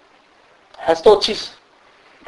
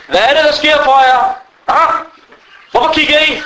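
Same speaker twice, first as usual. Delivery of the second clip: pitched up, scolding the people staring; indignant high scolding; 9 semitones higher than usual. Retold or imitated. Man in prayer scolding the staring people, high and indignant. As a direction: demand why they stare.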